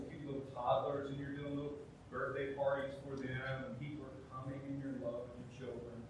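usual speaking voice

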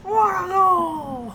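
high, drawn-out goal call; Joe Bowen. Acted. Friend re-enacts the announcer jumping in the box, high slow cry. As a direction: stretch it out as a mock hockey commentator. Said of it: "He's like, yeah".

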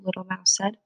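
plain reading voice

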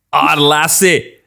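slow mock Scottish "Ah, lassie"; Scottish accent. Host asked how Scottish sounds, mock accent. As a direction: drawl it out like a Highland groundskeeper. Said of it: "hoe is Schots".

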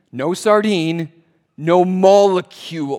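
loud, slow punch on "molecule"; emphatic. Shown as a demonstration. Preacher repeating the sardine point, emphatic. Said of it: "I'm saying it emphatically".

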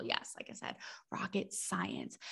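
dry sarcastic whisper, slow on rocket science; whispered aside. Real, not acted. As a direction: whisper the joke to the listener. Sarcastic aside after explaining name.

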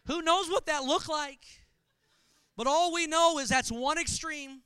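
usual speaking voice

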